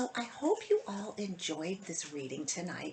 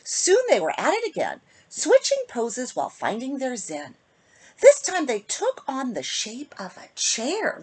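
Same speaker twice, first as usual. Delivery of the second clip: put-on lilting rhymed read-aloud for kids; storybook reading; 7 semitones higher, 8 dB louder than usual. A narrator's reading. Host reading a bedtime rhyme to kids, lilting. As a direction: read the rhyme to children, sing-song. Reads picture book to children.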